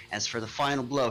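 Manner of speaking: ordinary talk, nothing marked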